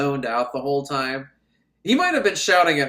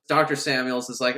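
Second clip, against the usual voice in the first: ordinary talk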